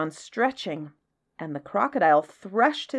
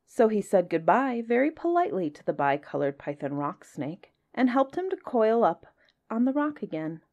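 ordinary delivery

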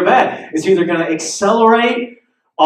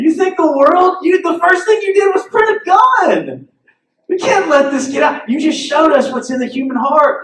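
scolding; 8 semitones higher than usual. Retold or imitated. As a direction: rebuke him from on high. Town leaders scolding the man.

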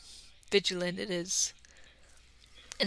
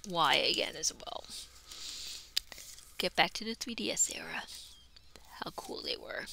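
whisper; 3 semitones lower, 8 dB quieter than usual. Real, not acted. Nostalgic hushed aside.

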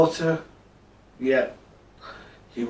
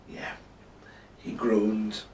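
usual speaking voice

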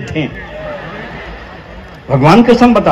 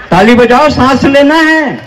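high, loud call for applause; raised comic stage call; calling to the crowd; 6 semitones higher, 3 dB louder than usual. Real, not acted. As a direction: pitch it up and demand claps. Calls on audience to clap.

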